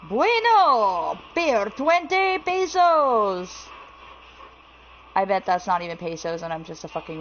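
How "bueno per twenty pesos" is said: loud, high, drawn-out mock Spanish accent. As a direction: shout it slowly in a hammed-up Spanish accent. Mock Spanish on finding money.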